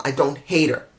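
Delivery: ordinary talk, nothing marked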